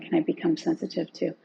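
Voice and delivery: usual speaking voice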